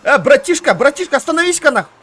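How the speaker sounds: loud call to stop; yelling across the room. Real, not acted. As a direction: shout to get his attention. Calling out to a passing player.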